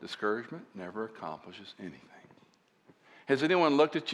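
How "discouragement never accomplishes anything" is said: soft, low and steady; quiet encouragement. Retold or imitated. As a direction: say it gently to a discouraged friend. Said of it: "And I said to his name".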